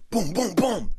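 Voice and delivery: high-pitched booming knocks; harder imitated knocking. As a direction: act out pounding on a door. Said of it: "You didn't knock loud enough".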